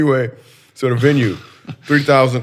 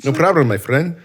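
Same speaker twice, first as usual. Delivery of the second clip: loud, higher German accent gone African leprechaun; botched German accent; 4 semitones higher, 7 dB louder than usual. Shown as a demonstration. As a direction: belt out a hopelessly wrong German accent. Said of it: "It's like an African leprechaun".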